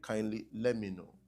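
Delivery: usual speaking voice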